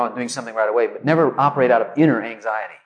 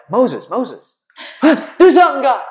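frantic Moses; 10 semitones higher, 5 dB louder, slower than usual. Retold or imitated. Moses panicking before Red Sea.

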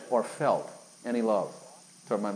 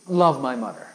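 forced out again; 3 semitones higher, 4 dB louder, slower than usual. Retold or imitated. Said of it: "so I finally said it again".

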